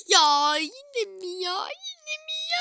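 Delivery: high, slow, whining complaint; whiny not again; exasperated whine. Pretend. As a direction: groan it out like a fed-up kid. Annoyed outburst at interruption.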